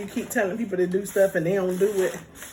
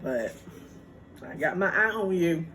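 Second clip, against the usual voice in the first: ordinary delivery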